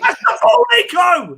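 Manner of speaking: very high, loud yell at a player; frantic shout. Real, not acted. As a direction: scream instructions at the pitch. Yelling at player during live match.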